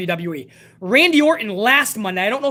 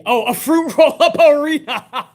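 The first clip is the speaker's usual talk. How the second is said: louder, cracking up mid-phrase at "fruit roll-up"; laughing; 4 semitones higher, 5 dB louder than usual. Real, not acted. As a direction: burst out laughing on the rereading. Rereading the comment, cracking up.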